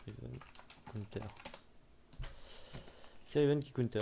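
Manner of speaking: talking between sips; words around a sip of drink; while sipping a drink. Real, not acted. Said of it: "Désolé, je bois un peu". (en buvant une gorgée)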